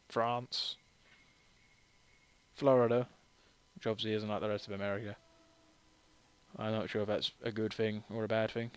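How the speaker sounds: ordinary talk, nothing marked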